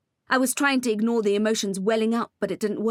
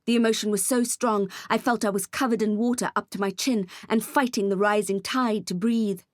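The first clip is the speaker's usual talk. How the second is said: usual speaking voice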